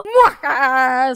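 low villainous muahaha; evil cackle. Acted. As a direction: cackle like a mad scientist. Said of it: "Se frota las manos malvadamente".

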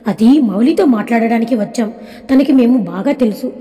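ordinary delivery, nothing marked

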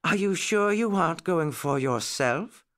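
high, lightly amused query; mildly playful nurse voice, no real laugh; teasing question. A narrator's reading. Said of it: "asked Nurse Jane with a laugh".

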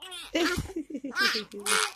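quiet giggle; soft laughing. Real, not acted. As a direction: giggle softly. Laughing at baby.

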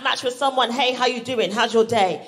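ordinary delivery

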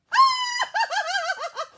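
very high, loud burst of laughter; delighted laughter. Acted. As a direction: shriek with laughter. Mother's joy as George barks.

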